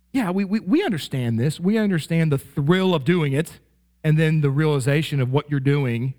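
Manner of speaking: ordinary talk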